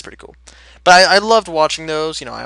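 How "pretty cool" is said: breathy whisper to himself; a soft whispered echo of the words; whispered aside. Real, not acted. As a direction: repeat it under your breath as a whisper. Repeats the phrase, second time whispered.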